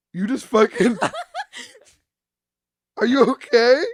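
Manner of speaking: high, loud, cracking up mid-line; breaking into laughter while imitating; laughing retold question. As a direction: quote a concerned dad through laughter. Cracks up quoting his dad.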